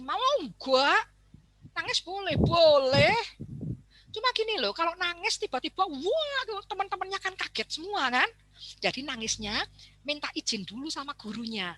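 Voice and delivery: high, gentle coaxing mother; reassuring mother. Retold or imitated. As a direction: coax the child kindly. Mother reassures her child.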